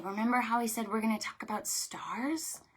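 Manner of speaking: soft quick whisper. Shown as a demonstration. Librarian whispering to children about stars. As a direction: lean in and whisper it. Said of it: "whispering to children".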